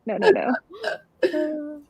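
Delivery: ordinary talk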